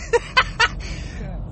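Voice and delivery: breathless squeaky laughter fit; laughter spilling over; high wheezing laugh. Real, not acted. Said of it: "Give me a second".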